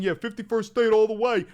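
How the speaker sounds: loud mocking caricature of a fan; lightly hammed-up dumb supporter voice; parroting a MAGA chatter with scorn. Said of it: "Now he's saying".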